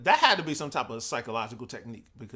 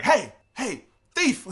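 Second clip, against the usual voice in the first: high, loud mock shout at a thief; mock yell; 11 semitones higher, 8 dB louder, much slower than usual. Store clerk yelling at a shoplifter, mock shout. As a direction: bark it like an angry clerk. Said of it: "they're not nice about it".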